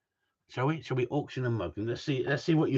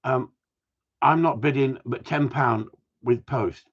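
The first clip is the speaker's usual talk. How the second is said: ordinary delivery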